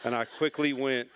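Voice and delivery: ordinary talk, nothing marked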